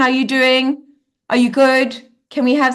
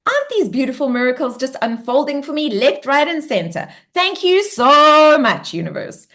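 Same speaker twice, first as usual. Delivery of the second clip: much higher, gushing thanks, fading at the end; gushing gratitude; 5 semitones higher than usual. Shown as a demonstration. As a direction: swoon over the miracles. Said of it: "I want you to be like".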